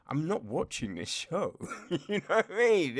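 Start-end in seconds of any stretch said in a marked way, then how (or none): 1.9-3.0 laughing. Real, not acted. Laughing at the show.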